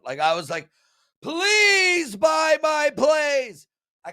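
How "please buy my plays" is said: loud, high, drawn-out plea; begging. Retold or imitated. Handicapper begging bettors to buy, loud hammed-up plea. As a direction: shout it slowly, begging hard. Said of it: "it's like the begging segment".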